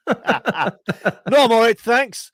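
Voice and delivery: high, loud hammed-up decline; loud embarrassed refusal. Shopper realises the old lady was being addressed, loud mock refusal. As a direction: call it out far too loudly and high. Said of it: "and I'm like".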